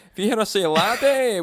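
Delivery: light mock Scottish accent; a hint of a put-on Scottish lilt; half-hearted Scottish accent. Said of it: "trying this accent".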